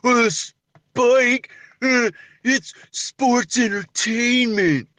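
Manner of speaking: slow, halting mock viewer voice; put-on voice of a hesitant objector; objecting viewer. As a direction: imitate a protesting fan, drawn out with pauses. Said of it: "to some of you at home, you may say".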